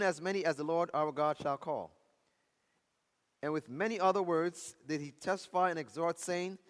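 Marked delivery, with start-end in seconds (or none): none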